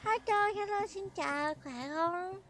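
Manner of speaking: ordinary talk, nothing marked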